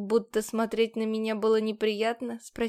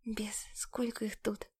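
slow, soft whispered curse; 10 dB quieter, slower than usual. A narrator's reading. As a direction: hiss it under your breath. Frightened aside under breath.

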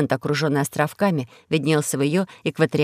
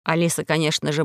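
ordinary delivery

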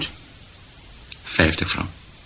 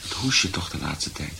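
quiet tired talk; 5 semitones lower, 11 dB quieter than usual. Acted. Quiet talk about her cough.

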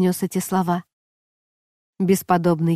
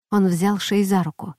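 plain reading voice

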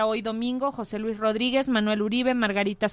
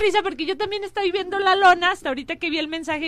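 laughing; 6 semitones higher, 7 dB louder, faster than usual. Real, not acted. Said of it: "es que me dio risa".

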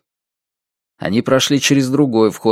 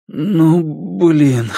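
raised, mildly exasperated grumble; reluctant grumble; 5 semitones higher than usual. A narrator's reading. Иван shakes his head before agreeing, reluctant grumble.

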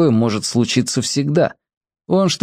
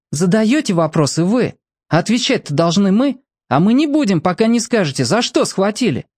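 put-on defiant boy; 9 semitones higher than usual. A narrator's reading. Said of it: "словно заледенел внутри".

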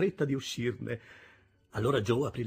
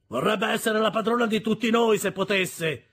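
loud, high, ugly sneer; sneering retort; 10 semitones higher, 8 dB louder than usual. A narrator's reading. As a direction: throw it back with a nasty sneer. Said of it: "ritorse Orlick con un brutto sogghigno".

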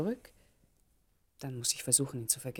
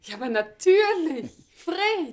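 laughing high on his name; surprised laugh; 10 semitones higher, 4 dB louder, slower than usual. Acted. Ruth laughs in surprise at meeting Fred. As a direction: burst out laughing as you recognise him. Surprised to meet old acquaintance.

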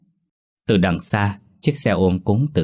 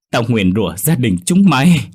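screamed curse, louder and higher; thét nguyền rủa; 4 semitones higher, 6 dB louder than usual. A narrator's reading. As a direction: hurl the curse out at full force. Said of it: "thét lên thật lớn". (bà cụ thét lên lời nguyền, cao và to)

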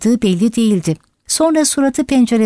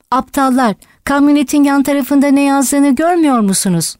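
higher-pitched, mildly indignant outburst; exasperated cry; 5 semitones higher than usual. A narrator's reading. Benjamin berating the blind animals, exasperated cry. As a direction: scold them urgently, voice pitched up.

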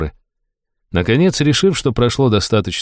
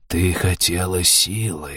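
soft, slow serpent voice; rustling snake voice; 5 dB quieter, slower than usual. Acted. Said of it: "шелестящий голос".

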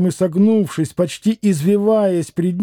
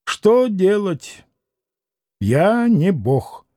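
slow, offhand but weighty; careless, imposing; much slower than usual. A narrator's reading. Doctor to the captain, slow offhand authority. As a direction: brush him off with practised authority. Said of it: "небрежным, хотя и привычно внушительным голосом".

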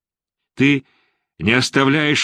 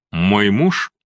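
без выражения. Acted. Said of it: "без всякого выражения сказала она".

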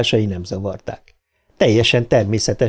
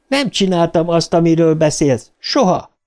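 put-on high-pitched girl firmly denying; protesting daughter; 6 semitones higher than usual. A narrator's reading. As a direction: deny it in a raised, higher voice. Beverly denies father's accusation. (magas hangon tiltakozó lány)

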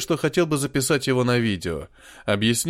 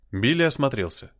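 usual speaking voice

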